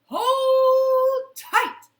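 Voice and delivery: high, loud shout of the magic words; yell. Acted. Peter yells the magic words at the goose, loud high shout. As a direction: belt out the spell. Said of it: "And Peter yelled".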